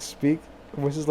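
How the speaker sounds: ordinary delivery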